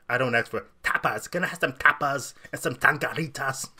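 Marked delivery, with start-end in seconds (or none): 1.3-3.7 loud, hammed-up Spanish restaurant accent; mock Spanish accent. Host parodying diners in a Spanish restaurant, showy Spanish accent. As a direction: overdo the Spanish accent on the food words. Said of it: "what I call situational accents".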